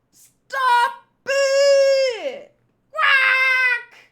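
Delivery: very high, loud crying shout; desperate wailing yell; panicked cry. Acted. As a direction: scream it as the flames catch you. Characters caught in the fire.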